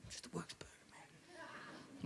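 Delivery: hushed, breathy burger order; whispered order. Retold or imitated. Ordering a burger at the counter, whispered. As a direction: mutter the order under your breath.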